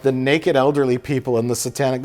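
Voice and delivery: usual speaking voice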